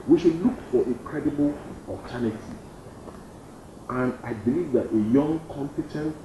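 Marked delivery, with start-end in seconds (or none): 5.5-6.2 competent set apart, a little higher; stress on competent. Shown as a demonstration. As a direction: lean on the word competent. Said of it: "I'm emphasizing on the word competent".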